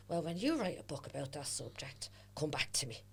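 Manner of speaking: soft, whispered comeback; a hushed, cutting reply; muttered retort. Retold or imitated. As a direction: say it under the breath. Retorting to critics under breath.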